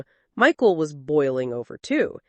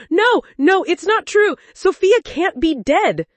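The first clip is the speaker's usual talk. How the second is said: much higher, louder cry of disbelief; anguished denial; 11 semitones higher, 6 dB louder than usual. Acted. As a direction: scream the denial in grief. Husband hears wife died.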